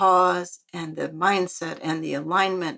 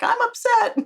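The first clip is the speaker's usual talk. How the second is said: high-pitched comic wail of protest; exaggerated, squealed retort; mock upset; 4 dB louder than usual. Retells own upset reply to nurse.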